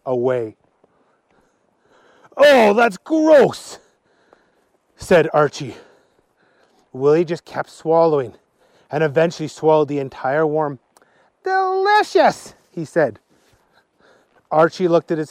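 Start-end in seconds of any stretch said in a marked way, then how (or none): 2.3-3.8 loud, high, very drawn-out revulsion; acted grossed-out cry; disgusted. Archie disgusted by worm swallowing. 11.4-12.5 very high, drawn-out relish; acted rapture over food; savouring delight. Willie savours the swallowed worm.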